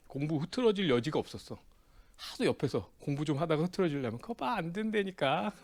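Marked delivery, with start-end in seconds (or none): 4.2-5.5 high, drawn-out teasing with laughter; gleeful taunt. Retold or imitated. Friend catching him slacking at study, gleefully taunting. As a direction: imitate a pal's slow, laughing I-told-you-so. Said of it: "꼭 옆에서 비아냥대요".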